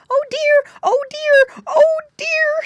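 very high, loud crying oh dear; a piglet squealing with his ear in the dog's teeth; high loud tearful squeal. A narrator's reading. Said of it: "squealed Squinty".

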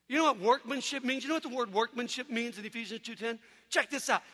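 usual speaking voice

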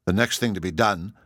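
plain reading voice

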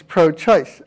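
"pro-choice" with a long oh in PRO; full PRO prefix. Shown as a demonstration. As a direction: say "pro-choice" as two stressed units. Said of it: "but we say it differently".

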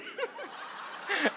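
high, real laugh breaking out; can't hold the laugh in; burst of laughter. Real, not acted. Laughing at his own story.

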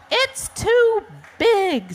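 slow, high-pitched squawk; shrieky storybook bird complaint; squawking auk. Acted. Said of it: "squawked the auk".

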